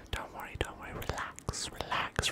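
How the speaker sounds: ordinary delivery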